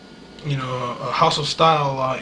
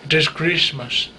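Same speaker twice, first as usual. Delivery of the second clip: Icelandic accent imitation. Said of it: "it's a real hard S".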